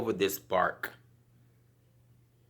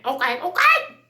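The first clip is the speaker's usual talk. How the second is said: much higher and louder, bubbly okay; excited; 8 dB louder than usual. Real, not acted. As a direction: burst out with a happy okay. Cheerful transition to work.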